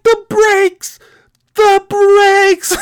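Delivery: very high, stretched-out yell; imitated screaming. Trucker hopping aboard runaway truck, imitated screaming.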